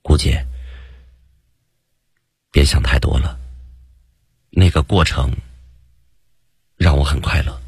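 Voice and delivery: hushed, gentle tone with long pauses; a tender, understated murmur to her; softly spoken. A narrator's reading. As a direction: speak gently and intimately, leaving space between phrases. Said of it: "我轻声地说道".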